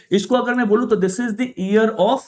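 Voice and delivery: usual speaking voice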